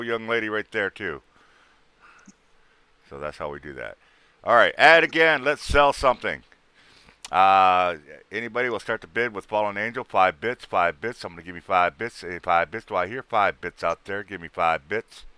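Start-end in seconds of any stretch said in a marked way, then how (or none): 4.4-15.3 auctioneer. Pretend. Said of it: "you sound like an auctioneer".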